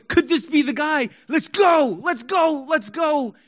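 high, eager cheering; excited crowd. Acted. People in Jerusalem hoping for a deliverer, excited. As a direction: cheer with rising hope, pitch up high. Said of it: "there were people all over going".

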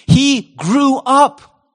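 very slow, each word weighted, up held high; slow emphasis. Real, not acted. Pastor driving home his main point to the congregation, slow and weighty.

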